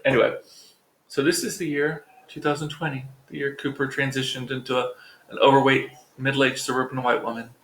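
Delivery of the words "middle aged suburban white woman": usual speaking voice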